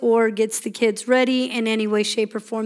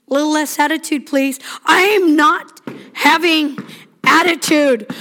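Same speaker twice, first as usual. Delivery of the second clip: scolding parent; 3 semitones higher than usual. Retold or imitated.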